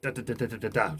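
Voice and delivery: robotic accuracy. Shown as a demonstration. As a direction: tick them out like a metronome. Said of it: "that kind of robotic kind of one".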